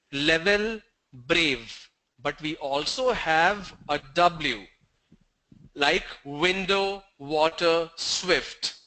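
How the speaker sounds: ordinary talk, nothing marked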